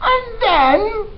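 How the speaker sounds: put-on high, loud, brash girl voice; eager Mig. Teacher voicing dim servant girl Mig, high and loud. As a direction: pitch way up and blurt it out loud.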